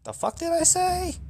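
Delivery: high, startled comic squeal; baffled squeak. Retold or imitated. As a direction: squeak it out like a guy caught off guard playing dumb. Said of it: "He's like".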